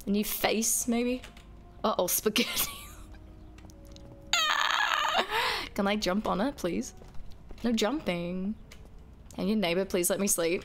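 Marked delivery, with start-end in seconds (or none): 4.3-5.7 high shrieking laugh burst. Real, not acted.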